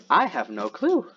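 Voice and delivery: normal talking voice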